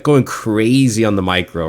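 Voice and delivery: ordinary talk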